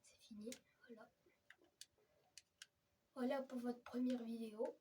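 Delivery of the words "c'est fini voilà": soft whispered ending. Real, not acted. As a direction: whisper it's over. Fin chuchotée. (chuchoté tout bas)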